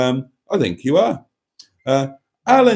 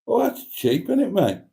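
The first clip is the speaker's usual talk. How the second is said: higher mock-impressed coo; mock admiration; 8 semitones higher than usual. Teasing the viewer about the cheap car, impressed. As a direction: sound wowed by the bargain, tongue in cheek.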